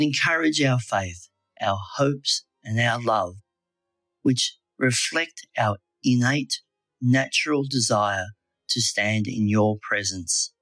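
usual speaking voice

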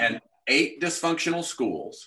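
slow, measured repeat for a listener; deliberately slow. Shown as a demonstration. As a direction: draw each word out so it sinks in. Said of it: "I'll say it slow".

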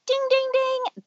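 high, singsong "ding, ding, ding"; alarm bell imitation. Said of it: "I'm like".

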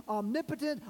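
ordinary talk, nothing marked

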